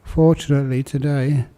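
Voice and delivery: calm meditation voice with long pauses; guided meditation. Real, not acted.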